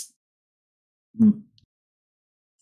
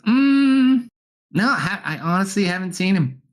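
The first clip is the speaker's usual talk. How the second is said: higher character voice; 9 semitones higher than usual. Acted. GM voices Sock.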